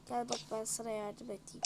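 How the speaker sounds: usual speaking voice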